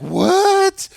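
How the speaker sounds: high, loud outraged squawk of disbelief; stunned yelp; shocked. Retold or imitated. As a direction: shriek it like the floor just dropped. Haman hears he must honor Mordecai.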